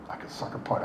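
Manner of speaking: barely voiced, breathy whisper of a violent thought. Real, not acted. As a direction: whisper it under your breath as if plotting. Inner thought of fighting.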